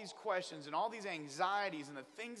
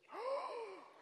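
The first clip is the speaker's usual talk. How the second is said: sharp, very high gasp of mock shock; 6 dB quieter than usual. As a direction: gasp as if betrayed. Shocked at wife's answer.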